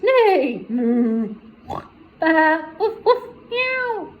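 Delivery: loud, high string of animal cries; deafening animal chorus. Acted. The farm animals drown out the thieves, loud and high. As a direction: bellow every animal call at once. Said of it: "let out a deafening sound".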